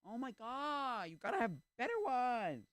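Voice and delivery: high-pitched, drawn-out frustration; exasperated wail. Real, not acted. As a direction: wail it, utterly fed up. Waving meme comes up again.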